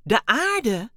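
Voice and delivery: high, loud shocked question; bewildered cry. A narrator's reading. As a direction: call it out in disbelief. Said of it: "riep Dexter verbijsterd uit". (verbijsterd en luid)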